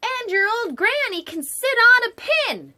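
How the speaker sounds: high, loud, nasty pig yell. A narrator's reading. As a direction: shriek the insult at the wolf. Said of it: "the third little pig yelled".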